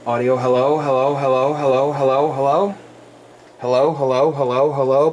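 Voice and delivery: steady test count over the air; radio mic check. Real, not acted. Ham operator testing his amp, radio check calls.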